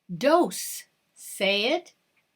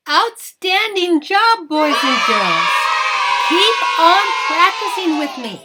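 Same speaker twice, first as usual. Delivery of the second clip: put-on big cheering praise for kids; excited praise; 2 semitones higher, 4 dB louder than usual. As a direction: cheer them on, loud and proud. Praising children after counting drill.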